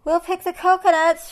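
high, loud monkey shout. A narrator's reading. Monkeys volunteer for the plan, shouted. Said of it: "shouted the monkeys".

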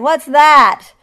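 high, booming demand; roared. A narrator's reading. Lion woken by squawking, roared. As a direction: bellow the question, startled. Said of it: "roared the lion".

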